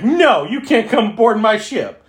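high, loud mock bossy refusal. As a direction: bark it out like a snooty captain. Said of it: "Vickers open it up and like".

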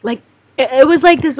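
normal talking voice

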